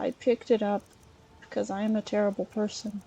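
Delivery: ordinary talk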